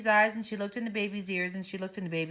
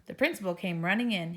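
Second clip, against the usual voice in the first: ordinary delivery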